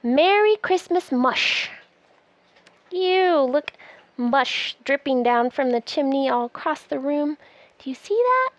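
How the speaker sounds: plain reading voice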